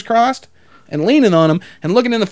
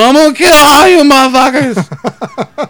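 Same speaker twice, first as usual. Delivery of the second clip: put-on drunk man ranting; 8 semitones higher, 7 dB louder than usual. Drunk with a pole threatening everyone, mock drunken yelling. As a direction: holler it like a hammed-up drunk. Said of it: "there's some drunk dude".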